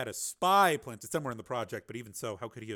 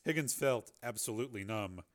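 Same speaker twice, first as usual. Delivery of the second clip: ordinary delivery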